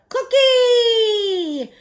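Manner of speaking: high, loud playground shout; children shouting. A narrator's reading. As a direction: yell it like a hungry kid at recess. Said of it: "Shouts of".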